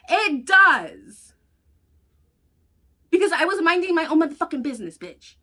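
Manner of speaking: furious comeback, dropping on the last word; angry retort. Retold or imitated. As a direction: snap it back at her. Retold retort in car.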